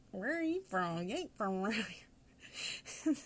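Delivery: imitating a thick Southern drawl; slow, drawn-out Southern local asking. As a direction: locals quizzing an outsider, in a heavy Southern accent. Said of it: "I have a Southern accent".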